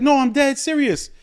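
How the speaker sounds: put-on very high, slow girl voice insisting. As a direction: stretch it out high like a tipsy fan. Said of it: "she's like".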